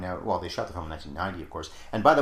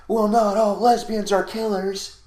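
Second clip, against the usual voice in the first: mock announcer; 13 semitones higher, 9 dB louder, slower than usual. Mock film message quoted.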